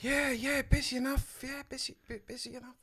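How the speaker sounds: put-on London accent. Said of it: "London accent".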